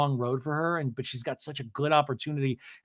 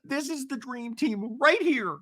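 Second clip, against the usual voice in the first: high, slow, hammed-up declaration; mock-solemn pledge to the group; overwrought gushing; 9 semitones higher, 4 dB louder, slower than usual. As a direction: proclaim it far too intensely. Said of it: "take it down all the notches".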